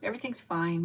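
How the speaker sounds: usual speaking voice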